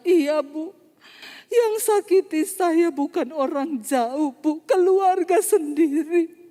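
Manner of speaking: slow, high, exaggerated weepy complaint; mock tearful wail. Hurt woman confiding in the preacher about her family, mock crying. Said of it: "Tiba-tiba dia mulai menangis".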